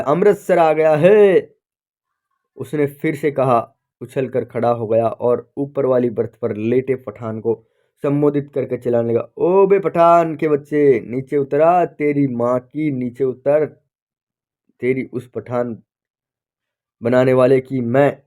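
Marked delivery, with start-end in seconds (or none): 0.0-1.5 louder and higher, slowly drawn out; excited shout. Acted. Clerk shouting to the whole train compartment, drawn-out excited yell. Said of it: "ऊंची ऊंची आवाज में चिल्लाया". 9.4-18.2 angry shouting. Acted. Clerk yelling abuse at the Pathan on the upper berth, furious. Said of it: "चिल्लाने लगा और चीख चीख कर गालियां बकने लगा".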